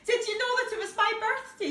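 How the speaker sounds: high, fast and bright, bursting to know; excited child's question. Acted. Gideon asking his friend, thrilled and high. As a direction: ask it high and quick like a thrilled little boy.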